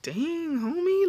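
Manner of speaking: high-pitched, drawn-out dismay; taken aback, voice lifting high; stunned reaction. Retold or imitated. Reacting to possible jail time.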